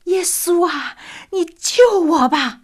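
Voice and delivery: high, loud, slow anguished call to Jesus; a dying man's loud plea for rescue; desperate cry. Retold or imitated. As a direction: cry out with your last strength. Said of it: "使尽最后的力量喊".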